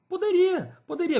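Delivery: a firm, high Poderia, poderia; emphatic yes. Real, not acted. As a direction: answer her own question with a burst of certainty. Emphatic self-answer to own question.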